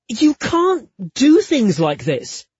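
high, loud, slow scolding; exasperated lover's telling-off; exasperated protest. Acted. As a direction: protest emphatically, drawing it out. Protesting partner's extravagant act.

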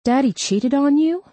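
high, disbelieving question; shocked surprise. A narrator's reading. Daughter stunned by her mother's confession, shocked surprise. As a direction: pitch climbing in astonishment. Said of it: "Sarah was so surprised".

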